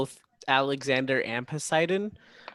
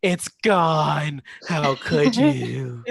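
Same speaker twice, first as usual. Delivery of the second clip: mock wounded Poseidon; 5 dB louder, much slower than usual. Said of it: "Poseidon's just ... sits up and's like".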